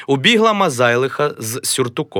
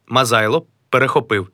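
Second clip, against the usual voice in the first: normal talking voice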